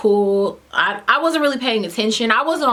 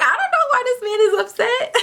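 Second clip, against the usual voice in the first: high, laughing bewilderment; hammed-up confusion breaking into laughter; baffled laughter; 13 semitones higher than usual. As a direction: squeal it through a laugh, totally baffled. Baffled aside at service desk.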